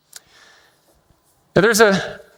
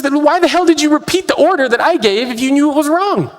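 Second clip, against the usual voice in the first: higher, mock exasperated question; exasperated captain; 9 semitones higher than usual. Captain to navigator who repeated a wrong order, mock exasperated. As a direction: demand an explanation, half-hearted anger.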